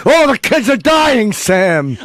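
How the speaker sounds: high, hammy soap-opera anguish; melodramatic TV doctor. Retold or imitated. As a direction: overplay a TV drama doctor. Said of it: "a Quincy episode".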